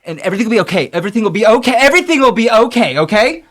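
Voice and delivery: fretful mother working herself up; rambling anxious mom. Acted. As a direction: ramble on, building toward frantic comfort. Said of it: "your mother just keeps rambling".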